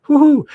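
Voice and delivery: loud, high whoop; whooping cheer. Real, not acted. Vlogger cheering his allergies kicking in, loud high whoop. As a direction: let out a big high "woo-hoo".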